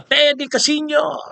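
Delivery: very high, slow mocking call of the name; mock Respicio calling out. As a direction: stretch out Teddy Casino in a high mocking call. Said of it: "Sabi ni Respicio". (mataas at mabagal na panggagaya)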